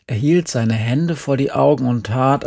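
usual speaking voice

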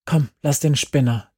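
ordinary talk, nothing marked

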